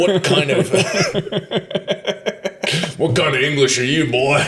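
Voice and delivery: hammed-up folksy puzzled American, laughing; mock Southern American. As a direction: play a baffled country local, laughing through it. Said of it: "they're like".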